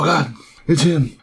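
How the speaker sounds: lightly acted dread; mock alarm. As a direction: imitate a startled character spotting someone. Acts out character's alarmed reaction.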